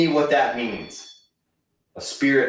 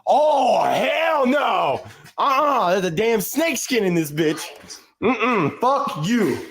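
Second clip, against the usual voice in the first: freaked-out outburst; 7 semitones higher, slower than usual. Real, not acted. Snake skin found in the house.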